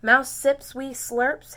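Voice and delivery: ordinary delivery, nothing marked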